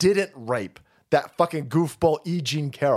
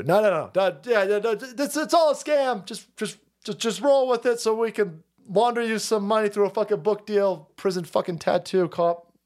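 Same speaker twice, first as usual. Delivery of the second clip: high, insistent scheming patter; coaxing handler; 5 semitones higher than usual. Acted. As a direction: talk over him high, pushing the scam. Handler coaxing, high against low criminal.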